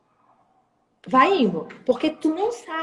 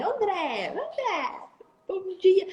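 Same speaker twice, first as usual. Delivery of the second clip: drawn out sweet name call; 6 semitones higher, much slower than usual. Shown as a demonstration. Saleswoman on the phone calling a client's name, sweetly stretched. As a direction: stretch the name out, high and friendly. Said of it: "tu puxa o nome".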